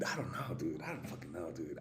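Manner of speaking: hushed, barely voiced whisper. Real, not acted. Vlogger whispering over a mystery powder, hushed. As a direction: whisper it as if afraid to be heard.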